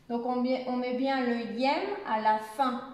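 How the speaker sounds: ordinary talk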